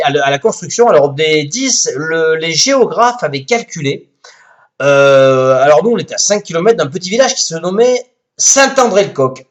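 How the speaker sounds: ordinary talk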